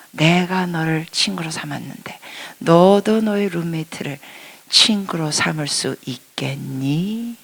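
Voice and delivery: soft, slow kind asking; God's gentle question. Retold or imitated. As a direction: ask it tenderly. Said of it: "너무나 부드럽게. 그것도 질문조로".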